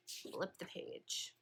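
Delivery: slow whispered aside. Real, not acted. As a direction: whisper the instruction off-mic. Quiet aside to class.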